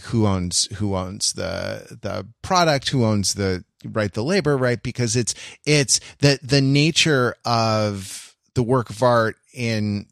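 ordinary talk, nothing marked

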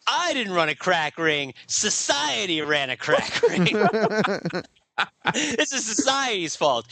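mock defiant gangster. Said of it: "And he's like".

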